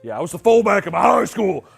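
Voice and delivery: hammed-up gravelly mechanic woman; tomboy mechanic. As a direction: boast loudly as a big tomboy jock. Continues tomboy mechanic impression.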